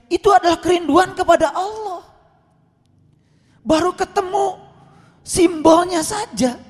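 very high, emphatic outburst; impassioned preaching. Real, not acted. Preacher explaining pilgrims' longing for Allah, impassioned. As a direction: cry it out to the congregation.

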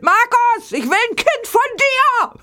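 lauter, hoher Schrei quer durch den Raum; brüll es dem Markus hin; gebrüllt. Retold or imitated. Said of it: "dann hab ich gebrüllt". (laut gebrüllter Liebesantrag)

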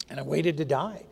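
normal talking voice

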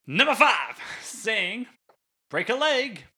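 high-pitched, hammed-up stage announcer; theatrical announcement. Host announcing the next saying, theatrically. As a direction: proclaim it in a showy high voice.

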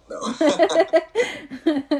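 loud, high laughing no. Real, not acted. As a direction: burst out laughing on it. Joking denial, laughing.

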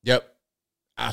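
ordinary talk

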